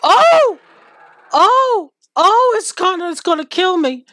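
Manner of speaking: very high, loud screams; scared horror game streamer. Real, not acted. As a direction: scream in terror. Screams as mob attacks.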